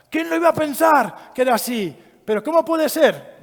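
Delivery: high, loud, hammed-up disbelief; mock astonishment. Neighbours feigning shock about an abuser, high and loud. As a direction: act shocked for show. Mocking people's feigned surprise.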